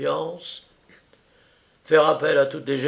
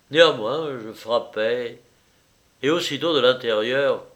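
ordinary delivery, nothing marked

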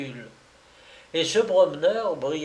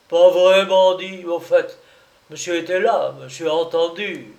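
loud, higher angry rebuke; furious; 3 semitones higher, 8 dB louder than usual. Acted. The client shakes the hawker and calls the narrator witness, furious. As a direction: shake him with your voice.

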